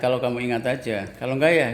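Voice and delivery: usual speaking voice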